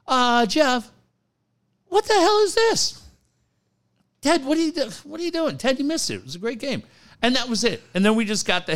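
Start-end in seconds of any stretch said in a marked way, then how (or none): 0.1-6.8 Ted's confused questions. Retold or imitated.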